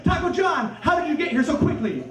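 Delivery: shouting up high in amazement; amazed. Retold or imitated. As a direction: gasp it out in wonder. Amazed at rescue.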